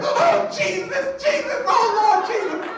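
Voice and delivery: piercing, drawn-out church-lady wailing; hammed-up ecstatic screaming, very high and loud; Holy Ghost frenzy. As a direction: imitate a woman shrieking praise, overcome. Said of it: "She went on a Holy Ghost tear".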